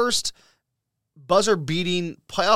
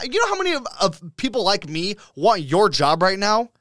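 higher-pitched, heated venting; exasperated rant; 6 semitones higher than usual. Real, not acted. Fan telling off a complaining commentator, exasperated rant. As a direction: rant indignantly, pitch riding high.

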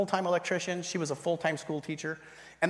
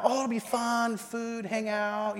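higher, sing-song mock sales pitch; breezy coaxing; 5 semitones higher, much slower than usual. Friend sells the home group to him, high drawn-out coaxing. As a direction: stretch it out slowly, high and coaxing.

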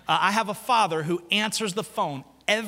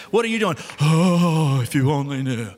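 busy person; 5 semitones lower, 5 dB louder than usual. Acted. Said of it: "the busy competition".

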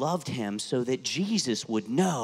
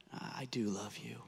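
a hushed whisper, much softer; whispered devotion; 3 semitones lower, 13 dB quieter than usual. Acted. Peter's third answer to Jesus.

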